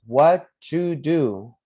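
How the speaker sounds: "What you do" very slow, as what, ju, do; loud, drawn-out "What you do" in three beats; three sounds. Shown as a demonstration. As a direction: spell out "What you do" by ear, "you" as "ju". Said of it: "Three sounds".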